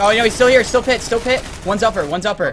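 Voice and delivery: loud urgent callouts; excited shouting. Real, not acted. Urgent enemy callouts mid-fight.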